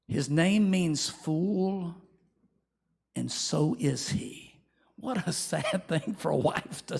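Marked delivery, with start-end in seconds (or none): 0.1-4.6 slow rueful admission. Retold or imitated. Abigail confessing.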